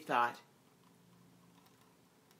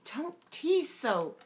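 put-on much higher and slow, a girl pleading; young Alice, teasing back; 13 semitones higher, slower than usual. A narrator's reading. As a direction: stretch it out like a girl who is fed up. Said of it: "said Alice".

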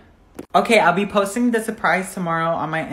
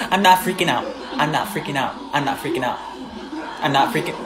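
repeated insistence, sinking lower and softer; failing calm; 5 semitones lower, 4 dB quieter, faster than usual. Acted. A panicking passenger insisting on calm, shaky self-reassurance. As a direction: tell yourself over and over, dropping away breathily. Acted denial of panic, shrinking.

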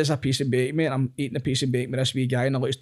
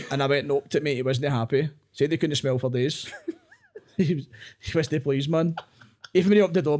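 normal talking voice

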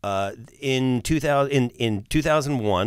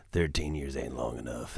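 low dramatic movie-trailer read; 9 semitones lower, 9 dB quieter than usual. Acted. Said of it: "the movie voice".

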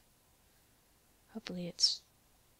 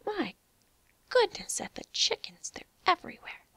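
high, amazed exclamation; 10 semitones higher, 3 dB louder than usual. Real, not acted. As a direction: marvel at the chickens. Reacting to many chickens.

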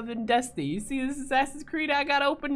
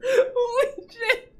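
high, slow, teary holy shit; laughing to tears; 14 semitones higher, 4 dB louder, slower than usual. Real, not acted. Streamer crying with laughter at duck. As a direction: barely get it out through laughter.

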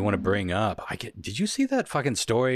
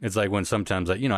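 ordinary talk, nothing marked

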